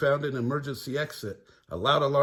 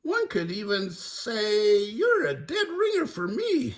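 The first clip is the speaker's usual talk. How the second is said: high, slightly hissing pharaoh voice; lightly voiced creepy mummy; mummy king hiss; 9 semitones higher than usual. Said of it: "hissed King Shaga Khan".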